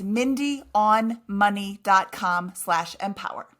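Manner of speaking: slow, spaced-out web address. Shown as a demonstration. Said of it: "That's why I said it slower".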